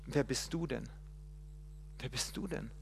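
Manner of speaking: very soft, fading question; nearly under the breath; questioning God. Acted. Moses asking God who he is, very soft.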